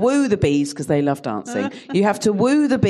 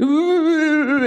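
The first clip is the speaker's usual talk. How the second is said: put-on a little kid's dazed ooh; small child's groggy coo; mimicking toddler; 9 semitones higher than usual. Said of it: "he was going".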